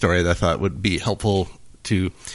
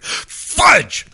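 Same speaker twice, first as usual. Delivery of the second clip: high-pitched burst of frustration; worked-up yell; 4 dB louder than usual. Acted. Priest after another bad golf swing, worked-up yell. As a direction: yell it like a golfer losing his temper. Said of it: "He's just getting worked up".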